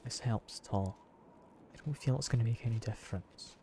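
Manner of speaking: a quick hushed sample for chat; quiet ASMR demo. Shown as a demonstration. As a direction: drop to a soft ASMR voice. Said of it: "I could definitely talk a bit quietly".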